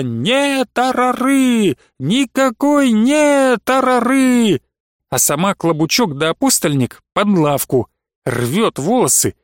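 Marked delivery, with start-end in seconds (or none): 0.0-4.6 высоко и очень медленно, нараспев; тяни исступлённо, как в припадке; кликушеский крик. A narrator's reading. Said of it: "По-прежнему кричала". (протяжный крик Иларии)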